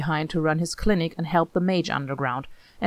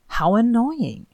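sighed; 5 semitones higher, slower than usual. A narrator's reading. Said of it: "Hawk sighed".

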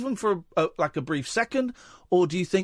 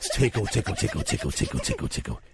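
dark tickle monster; 5 semitones lower than usual. Pretend. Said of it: "we'll make her do a dark version now".

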